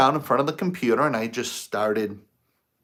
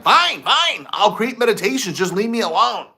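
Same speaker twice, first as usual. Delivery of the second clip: much higher, louder, fed-up surrender; exasperated giving in; 8 semitones higher, 4 dB louder than usual. Retold or imitated. Man answers the nagging inner voice, exasperated. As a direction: throw up your hands and give in. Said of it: "I'm like".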